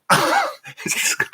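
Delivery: very high, slow falsetto curse; imitating cursing mother. Imitating queenly mother swearing before friends, drawn-out falsetto. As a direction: draw the swear out in mom's squealing voice. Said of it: "she looks like the Queen of England".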